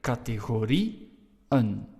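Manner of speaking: categorieën very slowly, break before the final "en"; separated syllables. Shown as a demonstration. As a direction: split categorieën into "categorie", pause, "en". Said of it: "we have to pronounce I, E, and E, N separately".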